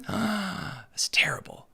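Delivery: breathy mock-horrified whisper; gasp, then whisper it in fake shock. Faking shock at the senator's arrest in a whisper. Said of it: "mock gasp at the arrest".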